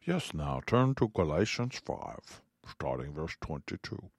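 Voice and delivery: a teasing lecture-hall voice; mock professor. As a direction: play the stuffy professor for a joke. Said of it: "I sound like a professor".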